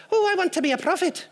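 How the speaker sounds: put-on eager boy with a light Irish lilt; a hint of an Irish kid, chirpy and fast; Irish accent. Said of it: "why your son is Irish".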